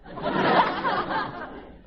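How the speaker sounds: very high cowboy cackle. Acted. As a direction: cackle at your own joke. Dan laughs at his own joke.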